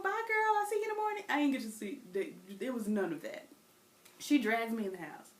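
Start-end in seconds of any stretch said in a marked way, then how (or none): 0.0-1.2 much higher breezy farewell to a friend; sing-song child's bye; little girl waving off her friend; cheery kid goodbye. Retold or imitated. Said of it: "turn around and say".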